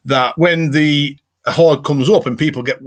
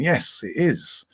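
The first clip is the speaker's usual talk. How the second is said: slow mock Mel reply; 5 semitones higher, 3 dB quieter, much slower than usual. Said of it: "And she's saying".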